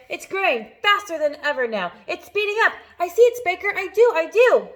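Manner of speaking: high, loud excited shouting. A narrator's reading. Aunts shout as the peach speeds up, high and loud. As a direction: cheer it on breathlessly. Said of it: "Aunt Spiker shouted".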